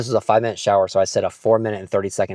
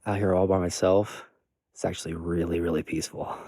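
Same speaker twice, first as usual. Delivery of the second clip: hushed; 3 semitones lower, 7 dB quieter than usual. Real, not acted. Alone at night, hushed.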